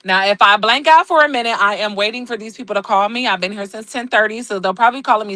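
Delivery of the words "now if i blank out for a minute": ordinary talk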